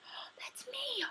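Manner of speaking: high-pitched squeal of recognition; excited recognition. Retold or imitated. Recalling first hearing [name], excited high squeal. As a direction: act out a thrilled discovery, way up high. Acting out her delighted reaction.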